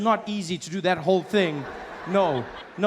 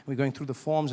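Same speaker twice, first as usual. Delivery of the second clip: ordinary talk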